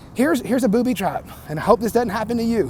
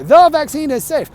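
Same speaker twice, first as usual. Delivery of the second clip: mocking quote; 6 semitones higher, 5 dB louder than usual. Mocking finger waggers' slogan.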